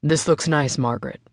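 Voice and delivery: a hint of a low father's voice, pleased; lightly voiced dad, friendly; smiling. A narrator's reading. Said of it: "he said, giving her a smile".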